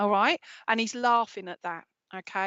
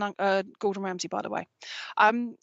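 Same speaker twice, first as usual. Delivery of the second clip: normal talking voice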